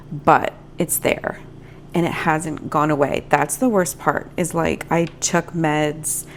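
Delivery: ordinary talk, nothing marked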